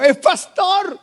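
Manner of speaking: put-on high, bright child's answer; happy little girl. Pastor voicing his daughter telling the teacher, high proud child voice. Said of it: "ella muy alegre le dijo".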